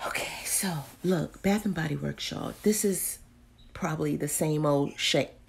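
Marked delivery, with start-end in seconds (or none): 0.1-2.5 soft whisper; whispered intro. Real, not acted. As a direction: whisper it like a secret.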